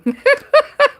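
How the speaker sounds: bright burst of giggling; high laugh. Real, not acted. Laughing at moving house card.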